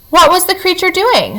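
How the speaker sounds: high squeaky weasel; quick nervous weasel question. A narrator's reading. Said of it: "said Dart to the, the weasel".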